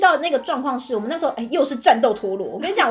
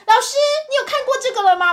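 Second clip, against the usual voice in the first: put-on high pestering child voice; eager kid; 11 semitones higher, 3 dB louder than usual. Boy asks the teacher again, high and eager. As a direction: keep nagging the teacher.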